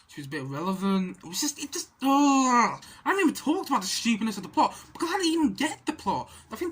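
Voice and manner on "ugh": wordless groan of frustration; groan, fed up with the film; exasperated groan. Real, not acted.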